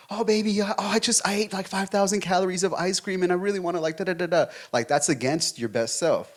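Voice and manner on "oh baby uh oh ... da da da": put-on higher, fast pleading girlfriend voice; mock whiny girlfriend. Girlfriend confessing her ice cream binge, mock high whine. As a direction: whine it up high like a guilty sweetheart. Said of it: "you know, like".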